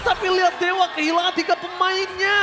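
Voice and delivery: play-by-play. Acted. Live play-by-play of fight.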